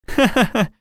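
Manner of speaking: high, light acted giggle; laugh. A narrator's reading. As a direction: giggle at the confusion. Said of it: "засмеялся Иван". (высокий смешок)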